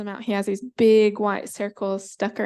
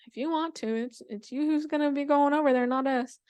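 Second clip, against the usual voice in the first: higher-pitched dismissive shrug; doubtful consent; 5 semitones higher than usual. Acted. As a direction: give in sceptically, washing your hands of it. Animals doubtfully let her go.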